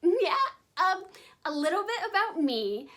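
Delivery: high giggly ditzy voice; bimbo voice. Pretend. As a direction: play the airhead party girl. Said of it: "I will cut the bimbo voice out".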